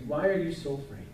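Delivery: gentle repeat. Acted. Jesus gently asks the disciples again, softly. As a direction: ask it again, quietly and kindly. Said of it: "Jesus turns to his disciples and says".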